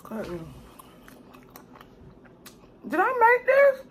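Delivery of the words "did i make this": high, mock-teary disbelief; amazed by own cooking. Real, not acted. Tasting her homemade Chinese food, high mock-crying amazement. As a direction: squeal it like happy tears.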